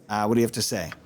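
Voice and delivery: ordinary talk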